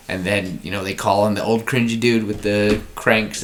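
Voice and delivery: ordinary talk, nothing marked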